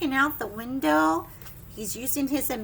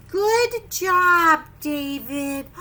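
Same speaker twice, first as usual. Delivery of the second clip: praising teacher; 7 dB louder, much slower than usual. Acted. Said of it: "It says".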